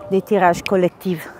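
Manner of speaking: normal talking voice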